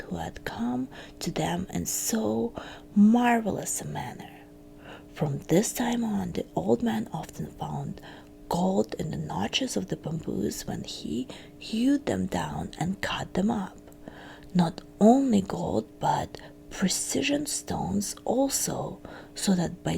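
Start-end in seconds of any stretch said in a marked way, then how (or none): none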